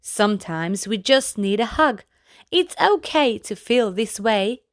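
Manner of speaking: plain reading voice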